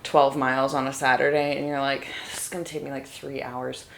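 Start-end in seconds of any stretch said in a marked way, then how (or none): 2.3-3.8 quiet, low-energy grumble to oneself; hushed inner groan; imitated dread. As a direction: softly voice a weary complaint. Quotes her dread before long runs.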